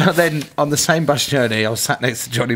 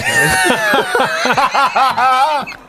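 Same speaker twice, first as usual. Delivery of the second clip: high, long burst of laughter; laughing; 3 dB louder than usual. Real, not acted. Storyteller cracking up at teammate's misery, laughing.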